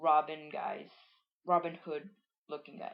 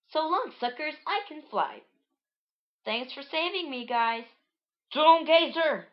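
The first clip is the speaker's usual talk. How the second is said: high taunting voice building to a loud shout; high-voiced character calling out; high cartoon character; 9 semitones higher, 6 dB louder than usual. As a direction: pitch it up, end on a shouted alarm. Voicing freed character, then alarm.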